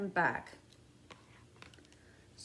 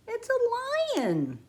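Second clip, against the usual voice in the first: high and slow, drawn out in awe; dramatic reveal; 12 semitones higher, 3 dB louder, slower than usual. Pretend. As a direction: unveil the lion with wide-eyed wonder.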